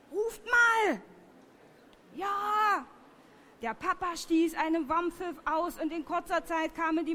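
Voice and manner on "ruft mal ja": high, drawn call; raised calling voice. Shown as a demonstration. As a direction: call it out as if across a distance. Demonstrates a warning call to children.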